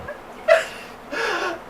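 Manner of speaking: high squealing laugh; shrieking laugh. Real, not acted.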